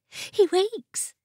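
high-pitched court lady's cry; excited cry. A narrator's reading. Court lady announcing the wizard has woken, crying out. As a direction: cry it out high, with mirth behind it. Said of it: "cried one of the court ladies".

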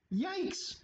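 a squeaky mock shriek; startled shout. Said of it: "shouted Ghoul".

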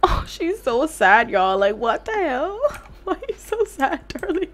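high, giggly pity; amused mock pity; laughing. Real, not acted. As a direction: laugh through the words. Laughing at sad sim.

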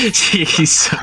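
high giggling exclamation; laughing. Real, not acted. Laughing at the screaming.

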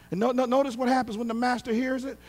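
ordinary delivery

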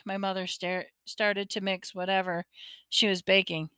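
ordinary delivery

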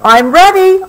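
high, loud eager announcement; child announcing she's dressed; bright announcement. A narrator's reading. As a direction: proudly announce she's ready. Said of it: "announced Sarah".

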